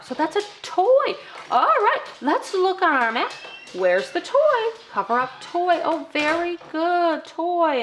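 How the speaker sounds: high, slow cheerful praise; child-directed praise. Shown as a demonstration. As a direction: encourage a small learner. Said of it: "just like I would talk to them".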